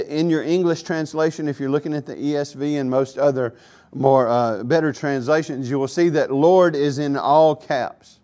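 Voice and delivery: ordinary delivery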